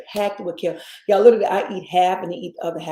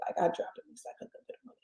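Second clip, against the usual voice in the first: whispered aside; 13 dB quieter, much faster than usual. Real, not acted. Confiding aside about the cost.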